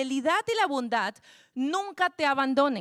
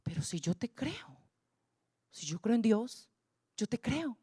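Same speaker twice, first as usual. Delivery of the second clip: hushed protest; 3 dB quieter than usual. Retold or imitated. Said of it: "Y yo me quedé".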